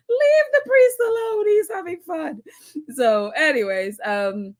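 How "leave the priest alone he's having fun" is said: high, loud protest, lightly hammed-up; mock outraged plea. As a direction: pretend to defend the priest loudly. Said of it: "everybody is like".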